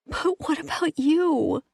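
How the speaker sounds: high, pleading cry; anguished plea. A narrator's reading. Woman fearing for captive, high anguished plea. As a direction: plead in distress.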